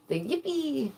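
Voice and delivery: high-pitched tiny cheer; cheering little character. Acted. Streamer voicing her first drawn character, high cheer. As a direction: squeal it like a cute cartoon creature. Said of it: "the very first character I created".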